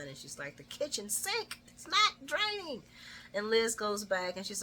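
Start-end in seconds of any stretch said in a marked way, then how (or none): 0.6-2.8 high-pitched whiny mock complaint; loud complaining. Recapper voicing Carly griping about the kitchen sink, loud complaining. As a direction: imitate a fed-up gripe, voice climbing high. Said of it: "starts complaining quite loudly".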